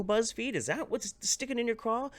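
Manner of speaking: high, mocking question at BuzzFeed; sarcastic jab. Real, not acted.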